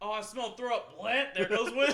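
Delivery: loud, high gagging cry; a friend shouting as he smells throw up; mock loud retching complaint. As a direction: ham up a friend's gross-out yell. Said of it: "I hear Will go".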